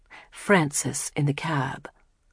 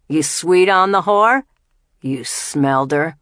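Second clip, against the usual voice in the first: mocking taunt; 6 dB louder than usual. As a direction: push harder, leering and loud. Jamil taunts Boutros.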